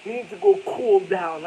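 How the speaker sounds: imitating a nagging wife; wife scolding him, a mocking imitation. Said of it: "my wife always be speaking like".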